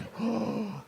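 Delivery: hammed-up gasp of amazement; mock-astonished intake of breath; shocked gasp. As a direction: gasp sharply at a reveal. Executives react to the twist.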